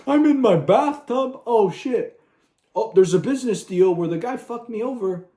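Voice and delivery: high, loud, whiny mock voice; squeaky mocking impression of a bathtub boss; mocking Boba Fett. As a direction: ham him up as a pampered softie in the bath. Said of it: "and he's like".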